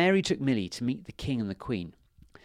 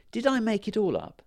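put-on wondering girl voice; 11 semitones higher than usual. Millie wonders aloud.

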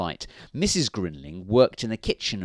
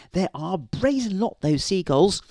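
high-pitched storybook wife; high, indignant wifely voice; Missus Grinling; 10 semitones higher than usual. A narrator's reading. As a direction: put on a high woman's voice, disapproving. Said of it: "said Missus Grinling".

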